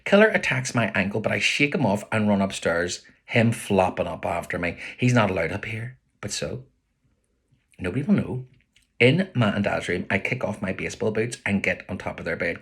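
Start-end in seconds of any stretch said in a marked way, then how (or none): none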